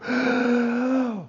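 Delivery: high, strained intake of breath; held-breath gasp. Acted. Pupil getting through a morning without breathing, gasping. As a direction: suck in air and hold it.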